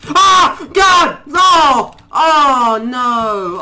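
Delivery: very high, very loud screams; terrified screams. Real, not acted. Gamer ambushed on screen, terrified screaming. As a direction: scream in full panic.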